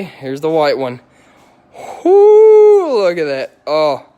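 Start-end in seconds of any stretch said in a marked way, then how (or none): 2.0-3.0 very high, loud "whoo"; a real joyful holler; excited whoo. Real, not acted.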